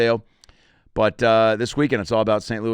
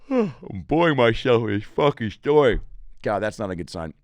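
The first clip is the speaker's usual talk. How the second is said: ordinary talk, nothing marked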